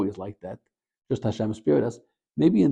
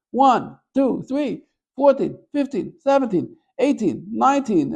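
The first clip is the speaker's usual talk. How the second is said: counting lashes much higher and louder, slowing; mock counting out loud; 12 semitones higher, 6 dB louder, slower than usual. Whipper counts the lashes before the crowd, hammed-up loud. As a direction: call each number like a showy whipper.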